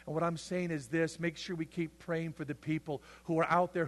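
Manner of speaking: ordinary delivery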